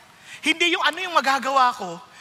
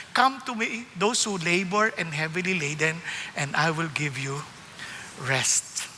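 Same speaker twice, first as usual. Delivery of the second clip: gentle invitation; 7 semitones lower, 4 dB quieter, slower than usual. Acted. Quoting Jesus' gentle invitation.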